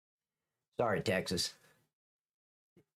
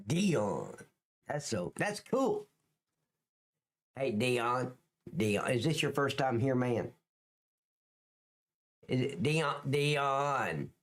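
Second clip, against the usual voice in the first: ordinary delivery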